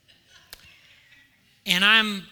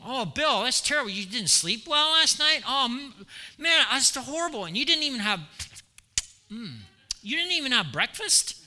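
hammed-up sympathetic cooing, higher; mock sympathy; 5 semitones higher than usual. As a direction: fuss over him with showy pity that gives nothing. Mock sympathy to hungry Bill.

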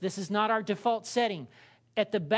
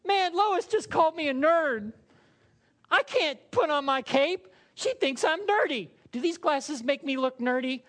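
high, louder whining mock Superman; a pouting superhero complaining about Lois; whiny Superman; 7 semitones higher, 4 dB louder than usual. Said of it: "and he's like".

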